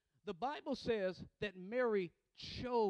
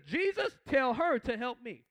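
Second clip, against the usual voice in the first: frustrated; 9 dB louder than usual. Acted. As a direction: cry out like an exasperated Martha, preacher-style. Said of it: "She's so frustrated".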